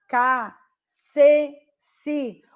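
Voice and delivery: "K, C, si" spelled out loudly like a drill; syllable reading. Shown as a demonstration. Teacher recites syllabary sounds for pupils. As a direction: read the syllables aloud clearly. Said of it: "leitura do silabário".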